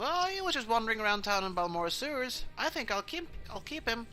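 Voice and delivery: high, quick daughter voice; light high voice, playfully claiming him; breezy high-pitched reply to dad; teasing daughter. Acted. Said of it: "And Cinder goes".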